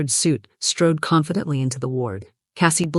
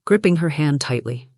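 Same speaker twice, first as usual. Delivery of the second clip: usual speaking voice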